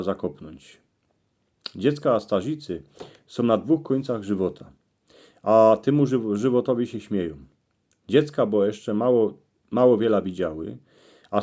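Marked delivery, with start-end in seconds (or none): none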